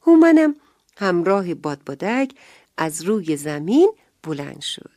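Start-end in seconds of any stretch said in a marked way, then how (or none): 0.0-0.5 higher, louder burst; excited. A narrator's reading. Storyteller thrills children as Houman rises, excited. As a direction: sell the wonder of lifting off.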